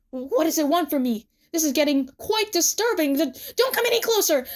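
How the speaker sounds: rising panic; Tsukasa. A narrator's reading. Tsukasa backs away from the plushie, increasingly panicked.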